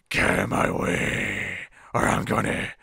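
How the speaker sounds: low, slow, rough villain growl; slow gruff menace. Retold or imitated. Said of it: "he's like".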